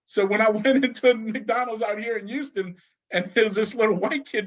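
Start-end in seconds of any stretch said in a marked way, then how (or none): none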